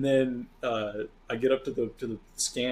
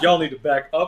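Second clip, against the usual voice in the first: louder, a bit higher order to back off; mock warning; 5 semitones higher, 9 dB louder than usual. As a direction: warn the crowd off like you've got a gun. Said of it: "I'm like".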